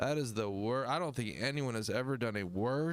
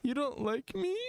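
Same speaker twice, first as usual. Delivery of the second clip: much higher mock-sad [name]; 13 semitones higher than usual. Describing [name]'s heartbreak voice, much higher mock sad. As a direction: whimper it like a hurt [name]. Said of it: "this is his sad".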